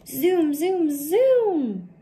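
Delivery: high, loud zooming cheer; thrilled flying-kite sounds; excited zoom. Acted. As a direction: send the kite flying with your voice. The kite flies.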